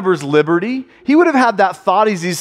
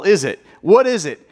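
normal talking voice

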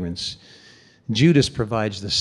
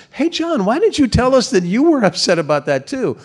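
teasing question to John; 10 semitones higher than usual. Retold or imitated.

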